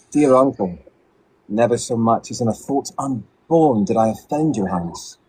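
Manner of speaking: a Shakespearean British accent, stately and measured. Shown as a demonstration. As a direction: recite it like a British stage actor. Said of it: "Can we hear [name]'s British accent?".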